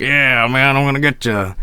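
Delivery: lightly voiced metal rocker growl-tease; a hint of a swaggering metal frontman.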